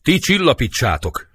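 high, loud command; urgent stage shout; called order. Acted. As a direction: call out an order from outside.

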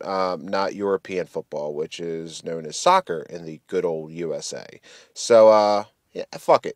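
normal talking voice

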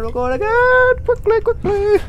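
a frightened squeak as it starts; panicky squeak. Real, not acted. Monster attacking again.

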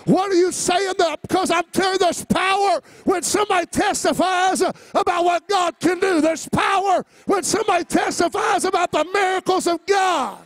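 high, loud shouted preaching; rising fiery sermon shout; preaching at full force. Acted. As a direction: preach it out to the whole church. Preacher testifying to church.

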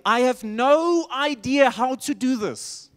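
slow, emphatic declaration of helplessness; war cry. Acted. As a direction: drag out a mock war cry.

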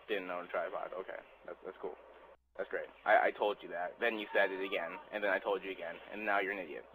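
sarcastic mutter. Real, not acted. Mutters sarcastically to himself after the tripod argument. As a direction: mutter it under your breath.

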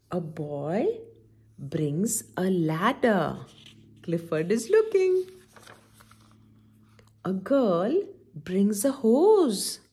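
slow, drawn-out storybook reading; reading to children. Acted. Auntie D reads Clifford aloud to the children, slow storybook voice. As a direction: read each line slowly, as if to little kids.

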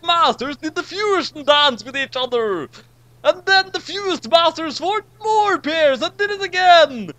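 high, loud, building epic narration; excited narration. A narrator's reading. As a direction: hype each fusion bigger. Climactic fusion narration.

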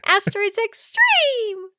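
high, slow, laughing hype voice; hyped announcer. Pretend. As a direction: say it like a game trailer. Echoing title, hyped.

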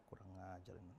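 a quiet irritated mutter; muttered aside. Retold or imitated. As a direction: grumble to yourself so the guest can't hear. Host mutters about rude child.

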